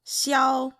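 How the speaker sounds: Xiao held high and level; Mandarin first tone. Shown as a demonstration. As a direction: keep Xiao even and high. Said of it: "first tone".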